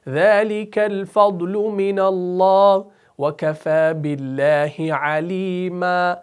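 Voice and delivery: slow, chanted tilawat; slow measured Quranic chant; Quran recitation. Shown as a demonstration. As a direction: recite melodically and slowly. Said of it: "recites verse again".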